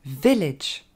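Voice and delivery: VILLAGE as "VEL-edge"; English VILLAGE with two short "eh" vowels; stress on first syllable. Shown as a demonstration. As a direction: hit the start of VILLAGE, drop to short "edge". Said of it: "Mettez bien l'accent sur la première".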